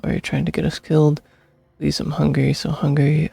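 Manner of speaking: ordinary talk, nothing marked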